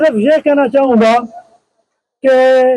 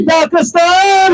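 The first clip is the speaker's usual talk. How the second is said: drawn-out shouted slogan, higher; booming political rally speech; 4 semitones higher, slower than usual. Real, not acted. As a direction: call the name out long to the crowd. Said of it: "मेरे नारों का जवाब देंगे".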